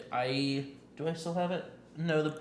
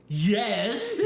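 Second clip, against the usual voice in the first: an eager, louder, higher Yes broken by laughter; excited yes; 6 semitones higher, 6 dB louder than usual. Retold or imitated. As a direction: imitate her gleeful yes, laughing. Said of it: "And she was just like".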